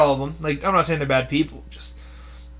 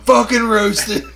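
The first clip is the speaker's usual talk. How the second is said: laughing gloat; 7 semitones higher, 7 dB louder, slower than usual. Real, not acted. Gloating after insulting friend.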